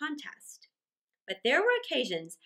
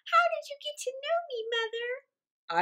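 put-on very high, quick little-boy question; small child's voice. A narrator's reading. Mother quotes her small son, very high childlike voice. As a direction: pipe it up like a curious child.

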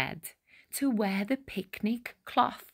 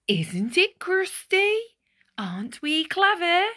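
high, loud, fast boastful cheer; proud shout; 9 semitones higher, 5 dB louder than usual. Acted. Villagers shouting proudly over their giant loaf, high and loud. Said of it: "raised a mighty shout".

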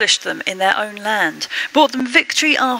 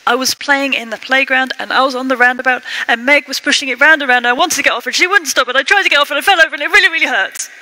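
put-on like a child trying not to cry, tumbling faster and faster; upset child speeding up; 3 semitones higher, 4 dB louder, much faster than usual. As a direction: voice climbing higher and racing faster as it spills out. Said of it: "their speech gets faster and higher pitched".

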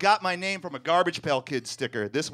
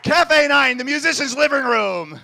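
high, loud shout to the crowd; rousing call-out; 7 semitones higher, 7 dB louder than usual. Real, not acted. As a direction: hail the venue like an emcee. Calls out venue to the crowd.

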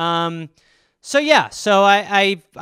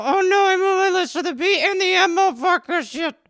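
high squeaky puppet voice, pleading; hand puppet calling for help; 11 semitones higher than usual. Acted. Puppet hand begging someone to rescue it, high pleading squeak. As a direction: cry for help in a tiny high voice.

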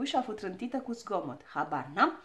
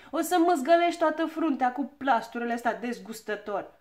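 loud, indignant scolding; cross shouting; 3 semitones higher, 8 dB louder than usual. A narrator's reading. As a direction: berate him at full voice. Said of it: "strigă Ochii Albaștri". (dojană indignată, cu glas tare)

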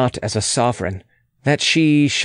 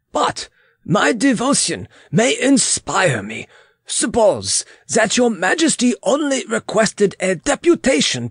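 put-on high, accented servant's voice; humble foreign attendant; 9 semitones higher than usual. Weber offering advice to royalty, humble and high.

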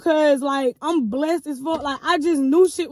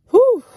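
high, loud exasperated whew blown out; 10 semitones higher, 8 dB louder than usual. Real, not acted. To viewers about the liar, a loud whew. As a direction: let out a big exasperated whew. Exhale after describing the lying.

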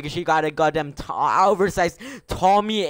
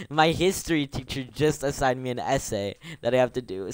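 ordinary talk, nothing marked

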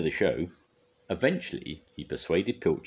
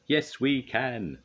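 slow, drawn-out higher cheer; group reply; 7 semitones higher, much slower than usual. A narrator's reading. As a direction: answer as an eager crew in chorus. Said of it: "the other machines replied".

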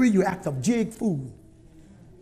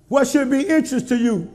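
ordinary delivery

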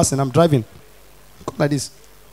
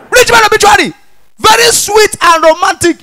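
exclaiming; 11 semitones higher than usual. Acted. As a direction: hype up the rich man's send-off. Mocking rich obituary.

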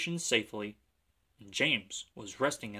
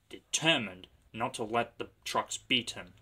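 ordinary delivery, nothing marked

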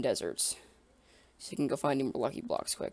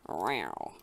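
low mock growl; 10 semitones lower, 5 dB quieter than usual. Pretends to be a cat.

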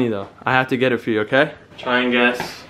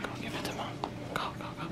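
whisper; 21 dB quieter, much faster than usual. Real, not acted. Sending the dog to Mom.

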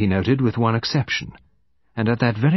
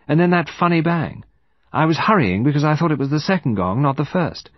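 higher-pitched, emphatic recollection; young woman recalling; 7 semitones higher, 4 dB louder than usual. Acted. Woman recalling events for detective, suddenly sure. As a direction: pitch it up, working out the time aloud.

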